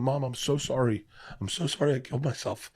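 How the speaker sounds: hushed apology, voice rising with emotion; emotional, tearful apology. Retold or imitated. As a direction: retell it close to tears. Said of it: "I'm gonna get emotional telling you".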